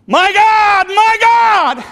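high, full-voiced anguished shout; loud cry. Jesus crying from the cross, loud cry. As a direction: cry out at the top of your voice. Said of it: "He cried out in a loud voice".

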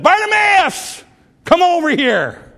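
high, drawn-out shout; Jesus calling out. Acted. Jesus calling blind Bartimaeus from the road, calling out. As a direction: holler to someone across a crowd. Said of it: "called for blind Bartimaeus".

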